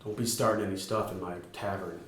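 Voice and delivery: a stern barkeep warning quietly; stern bartender. Acted. The bartender warns the party against trouble in his tavern, low and stern. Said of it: "he just kinda stops and says".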